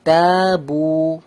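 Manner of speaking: drawn-out syllables, very slow; slow careful reading. Shown as a demonstration. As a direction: spell it out slowly. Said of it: "reading the word slowly".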